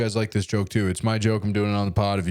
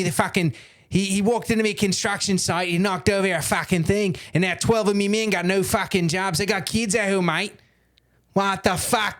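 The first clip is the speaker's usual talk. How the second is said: put-on higher-pitched, irate Aussie tradesman accent; angry Australian worker; 7 semitones higher than usual. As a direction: rant like an aggrieved Australian builder. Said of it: "Australian construction workers be like".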